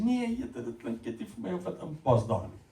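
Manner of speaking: amused dismissal, dropping soft; lightly voiced God shaking his head; God declining. God gently turning down Adam's name, amused. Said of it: "Hy sê".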